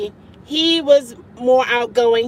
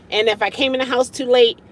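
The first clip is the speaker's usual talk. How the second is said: normal talking voice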